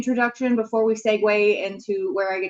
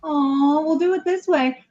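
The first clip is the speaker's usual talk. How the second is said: usual speaking voice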